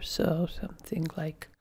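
whispered under the breath while working; a quick aside, whispered; muttered whisper. Real, not acted. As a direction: mutter it to yourself as you type. Muttering to self while typing.

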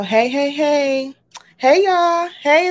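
high, loud call of hey to the crowd; loud excited greeting. Real, not acted. Host hollering hello to viewers hopping on, loud and excited. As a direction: belt out a welcome to everyone arriving.

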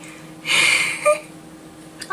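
breathless high-pitched giggle fit; real, helpless giggles; giggling at her own blooper. Real, not acted.

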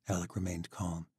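ordinary delivery

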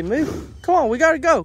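ordinary talk

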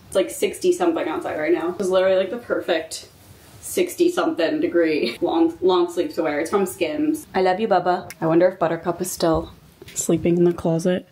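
usual speaking voice

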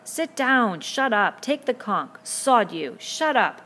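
loud, high jeering commands; angry heckles from a crowd; savage crying out. A narrator's reading. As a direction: shout him down. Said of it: "The assembly cried out savagely".

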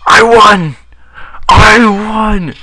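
very high, very loud victory cry; screaming I won, ecstatic; shrieking triumph. Real, not acted. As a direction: scream with joy at winning. Winning the race.

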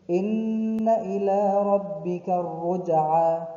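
tajweed recitation; Quran recitation. Acted. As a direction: chant the verse. Recites the verse.

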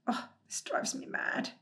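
breathless whispered frustration; exasperated whisper. Acted. Hemulan to himself over his list, exasperated whisper. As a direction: hiss it out, fed up and frantic.

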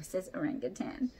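usual speaking voice

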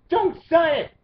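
sharp high yell; shouted plea. Real, not acted. Vlogger yelling at viewers not to sign, high and loud. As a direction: bark it out at the viewers.

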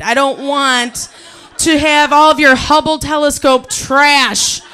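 loud, laughing through the rant. Real, not acted. Ranting to the audience about exes, loud laughing. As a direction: half-shout it while cracking up.

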